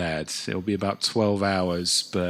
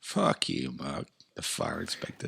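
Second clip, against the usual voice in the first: mock dismissive; 6 semitones lower, 6 dB quieter than usual. Host mocking his own persona, low and deadpan. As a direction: lower and softer, a deadpan brush-off.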